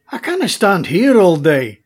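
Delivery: put-on Scottish accent. Duncan impatient to leave.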